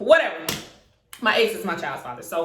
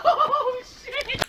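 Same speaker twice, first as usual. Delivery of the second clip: high laughing oh shit; laughing exclaim. Real, not acted. Telling viewers about the fight, laughing high.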